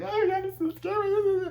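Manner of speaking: slow, very high falsetto whimper; mock terror. Alice sitting alone in the cabin, high mock whimpering. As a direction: whimper in hammed-up fear. Imitates Alice cowering in fear.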